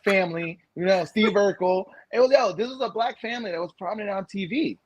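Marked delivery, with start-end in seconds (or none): none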